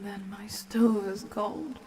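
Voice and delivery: ordinary talk, nothing marked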